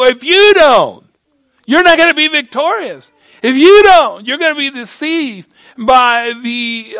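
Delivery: loud, rising preacher's cry; full-force preaching. Real, not acted. Preacher warning young people, loud and rising. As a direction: drive it home to the congregation.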